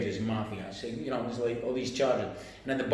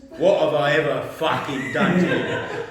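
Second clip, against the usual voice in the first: mock furious boss, loud and drawn out; slow, loud roar with the swearing leaned on; angry outburst; 7 dB louder, slower than usual. Boss confronting his employee again, loud acted anger. Boss repeats question, raging.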